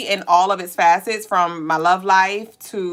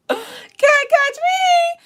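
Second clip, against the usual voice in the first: high, loud and slowly drawn out; high sung-out tease; teasing singsong; 4 dB louder, much slower than usual. Pretend. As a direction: taunt them playfully like a game of tag. Playful teasing call.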